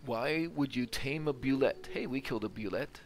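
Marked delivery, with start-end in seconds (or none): none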